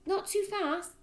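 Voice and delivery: put-on a boy checking his notes; son. A narrator's reading.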